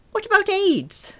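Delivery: very high, loud blurted panic. A narrator's reading. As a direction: let it burst out before you can stop it. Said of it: "the words came out as".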